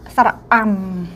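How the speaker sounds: 사라암 drawn out as a long vowel; vowel demo. Shown as a demonstration. Teacher demonstrates the Thai vowel 사라암 to learners. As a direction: model the vowel 사라암 clearly. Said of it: "사라암이라는 중모음이 있어요".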